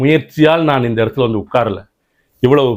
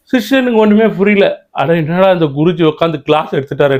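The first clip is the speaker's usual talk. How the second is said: ordinary talk, nothing marked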